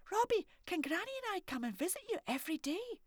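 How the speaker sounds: put-on high, bright child's voice; a curious little girl asking boldly; little girl Morag. Morag asking the old man if he's a haggis, high child voice.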